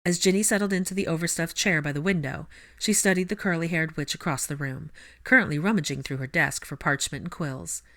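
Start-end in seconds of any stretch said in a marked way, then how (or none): none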